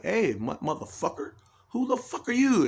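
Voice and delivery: lightly voiced mantis picking a fight; tough praying mantis. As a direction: challenge like a cocky little bug. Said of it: "a praying mantis will be like".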